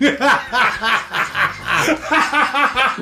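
giggling mock Russian "Oh, niet"; laughing through the words. Laughing catchphrase.

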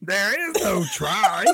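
slow Southern-drawled Yoda line. Acted. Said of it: "It sounds like Redneck Yoda".

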